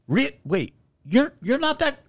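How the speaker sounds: high, halting mock disbelief; confused fan. Kid doubting the denial, baffled and halting imitation. Retelling a confused fan's reaction.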